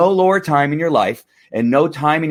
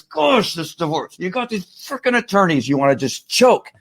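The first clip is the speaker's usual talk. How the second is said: high-pitched, frustrated venting; hammed-up overwhelmed fuming; worked-up exasperation; 7 semitones higher than usual. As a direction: rant as if at the end of your rope. Acts out overwhelmed divorce rage.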